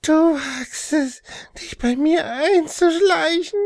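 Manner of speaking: high, slow, unearthly menace; demon voice. Acted. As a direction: snarl it like a possessed demon. Demon confronts the intruder.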